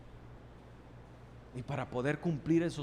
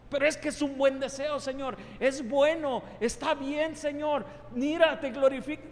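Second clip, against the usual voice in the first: high, loud begging plea to the Lord; pleading believer; 11 semitones higher, 6 dB louder than usual. Acted. A believer begging the Lord to accept his plan, loud pleading. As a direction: beg God to accept your plan.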